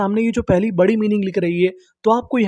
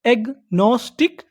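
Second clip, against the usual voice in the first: Agnostic in slow separate parts, rising; syllable by syllable; slower than usual. Shown as a demonstration. Teacher prompting learners for the English word, slow and rising. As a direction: say agnostic slowly as एग-नोस-टिक. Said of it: "English में क्या बोलते हैं".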